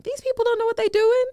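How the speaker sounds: loud, high squeal of disbelief; incredulous high-pitched outburst; high-pitched exclamation. Real, not acted. Exclaims incredulously about corrupt politicians.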